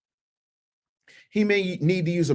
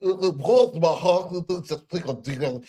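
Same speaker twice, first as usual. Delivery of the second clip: mocking the DA's voice. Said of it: "Is he underwater when he talks?".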